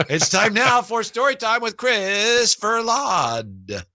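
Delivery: slow, high, drawn-out show intro; announcer voice. Acted. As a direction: announce the segment like a radio host. Host announces the story segment.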